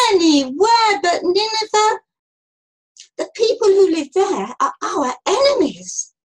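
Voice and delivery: loud, high-pitched complaint; moaning protest. A narrator's reading. Jonah objecting to visiting his enemies, moaning. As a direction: protest in a big, wailing moan. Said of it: "He moaned".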